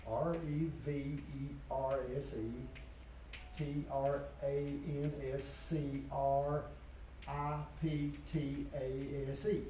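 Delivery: slow, letter-by-letter spelling, each letter set apart; spelled out letter by letter. Shown as a demonstration. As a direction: spell it out slowly so students can copy it. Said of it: "I want you to know this".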